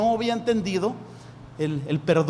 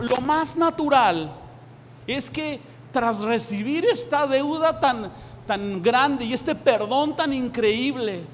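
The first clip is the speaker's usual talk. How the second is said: rising, excited preaching voice; a hint of overjoyed daydream; building joy; 5 semitones higher than usual. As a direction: paint the joy, lifting higher. Builds joyful imagined scene.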